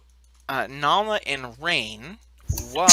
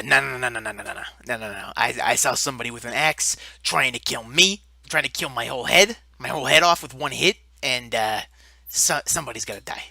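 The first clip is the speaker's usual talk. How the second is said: low, hotheaded giant voice; fire giant voice; 5 semitones lower, faster than usual. Acted. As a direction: growl it low like a riled-up giant. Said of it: "And the fire giant is like".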